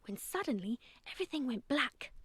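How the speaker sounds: usual speaking voice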